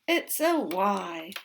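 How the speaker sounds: loud, slow It's a Y; slow reveal. Shown as a demonstration. Teacher revealing the spelling to kids, slow and loud. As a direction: draw out the answer loudly. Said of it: "teacher reveals answer".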